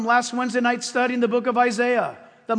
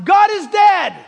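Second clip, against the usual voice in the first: loud, high declaration; a loud mock pronouncement of God is dead; proclaimed announcement; 7 semitones higher, 8 dB louder than usual. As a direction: announce it like an official decree. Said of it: "and announced".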